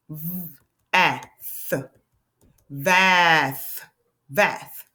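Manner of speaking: segmented sounding out, stretching each sound. Shown as a demonstration. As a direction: tap out v, ah, f slowly. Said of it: "Nonsense word".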